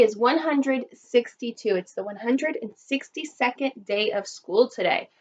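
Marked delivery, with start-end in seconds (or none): none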